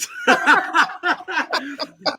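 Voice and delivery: long high laugh. Real, not acted. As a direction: burst out laughing. Laughs after insult.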